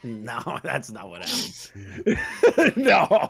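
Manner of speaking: slow, laughing through it. Real, not acted. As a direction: laugh out the denial. Laughing while denying.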